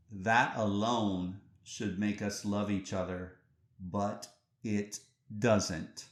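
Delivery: deliberate pauses, each word of "but it doesn't" set apart; slow, weighty repeat. Real, not acted. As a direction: let "but it doesn't" drag out slowly. Quoted line repeated slowly for weight.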